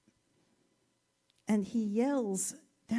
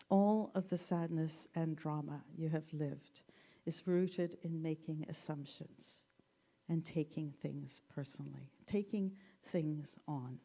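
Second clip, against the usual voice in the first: quiet solemn reading; 5 semitones lower, 5 dB quieter than usual. Shown as a demonstration. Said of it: "From the book".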